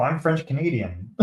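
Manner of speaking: normal talking voice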